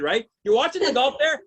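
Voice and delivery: normal talking voice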